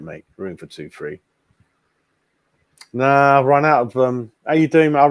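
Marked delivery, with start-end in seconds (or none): 0.4-1.2 much softer and lower, muttered to himself; soft low aside echoing the last line; quiet murmured echo. Real, not acted. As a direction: repeat it under your breath, much softer. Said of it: "So I think there's room for two, three yet".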